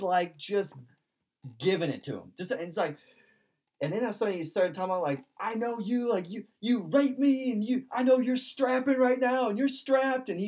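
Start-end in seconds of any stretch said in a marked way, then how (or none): 5.4-10.3 high-pitched, mock ranting accusation; retold yelling. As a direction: rant like the yelling man, voice pushed high. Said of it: "then just starts yelling at him".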